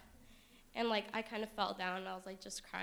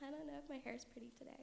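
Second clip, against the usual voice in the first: fishing for compliments; 6 semitones higher, 10 dB quieter, much faster than usual. Retold or imitated. Mimicking herself fishing for compliments.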